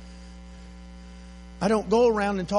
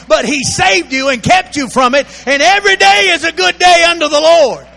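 rising to a loud, higher shouted sermon; building preaching; 7 semitones higher, 11 dB louder than usual. Acted. Preacher to congregation about the Lord's day, building to a loud shout. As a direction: preach it up until you're shouting praise.

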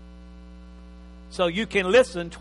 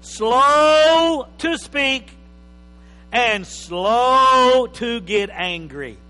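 preaching; 5 semitones higher, 9 dB louder, much slower than usual. Real, not acted. Preacher reads scripture with force.

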